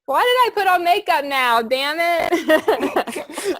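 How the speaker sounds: high, loud laughing wail; half laughing, half crying at herself; mock-exasperated laugh as the tears come; laughing through tears. Real, not acted.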